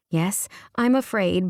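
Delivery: ordinary delivery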